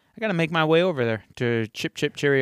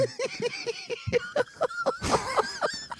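high, helpless laughing fit; real high-pitched laughter; long laugh; 6 dB quieter than usual. Real, not acted. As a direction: crack up in soft high peals. Cracking up at the Shaft joke.

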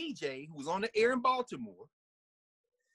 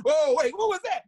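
higher and louder, startled; jolted by a strange note; mock startled listener; mock surprise; 8 semitones higher, 8 dB louder than usual. Mock listener reacting to blue note.

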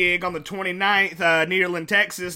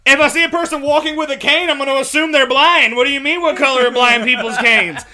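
loud, high-pitched disbelief; hammed-up indignation, pushed loud and high; incredulous outburst; 5 semitones higher, 7 dB louder than usual. Comedian replaying his reaction to the driving test, incredulous.

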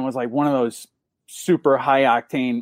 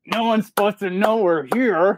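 higher-pitched retold protest; exasperated, pitched-up reminder; mock dismay; 6 semitones higher than usual. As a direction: protest as if the secret is blown. Retold dismay at flashy parking.

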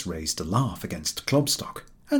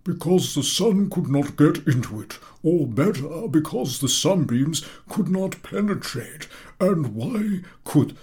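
high, slow correcting rephrase; pedantic professor; 7 semitones higher, 3 dB louder, slower than usual. A narrator's reading. Professor improving the boy's answer, high and slow. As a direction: polish the phrase.

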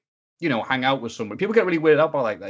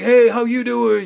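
loud, high, drawn-out hammed-up hello; overeager greeting; 12 semitones higher, 7 dB louder, slower than usual. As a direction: greet them far too keenly, stretching it out. Acts out his overeager greeting back.

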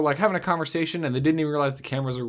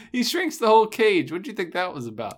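ordinary talk

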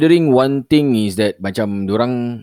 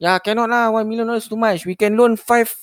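scam caller voice; 6 semitones higher than usual. Retold or imitated. Caller haggling loan amounts.

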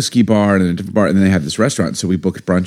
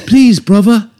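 put-on high, slow little-girl London accent; little British girl; 13 semitones higher, 6 dB louder, slower than usual. Husband's London accent done as a little British girl. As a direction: plead like a small English girl. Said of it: "think of a little British girl".

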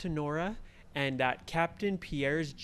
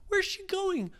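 "Where's" pushed much higher and a little louder; "Where's" pitched way up in rising panic; more frantic; 14 semitones higher, 4 dB louder than usual. Acted. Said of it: "Ryan said".

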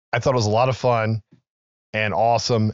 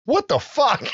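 much higher, stunned cry; shocked what the fuck; slower than usual. Retold or imitated. Family seeing Santa come back to life, shocked and high. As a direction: gasp it out in disbelief.

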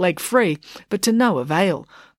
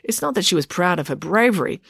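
usual speaking voice

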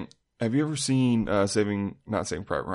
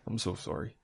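put-on Canadian accent; 2 semitones lower, 11 dB quieter than usual. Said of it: "he's not that Canadian".

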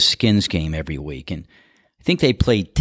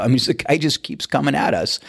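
normal talking voice

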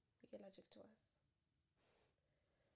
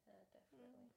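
ordinary talk, nothing marked

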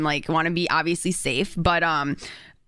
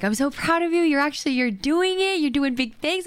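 mock sweet classmate; 6 semitones higher than usual.

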